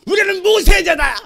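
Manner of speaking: high, loud indignant shout; hammed-up outraged declaration; angry Pharisees. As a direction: throw it at him in mock fury. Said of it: "막 욕을 하면서 막 승질을 내요".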